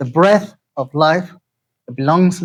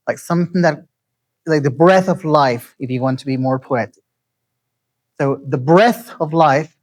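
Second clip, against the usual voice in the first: ordinary talk, nothing marked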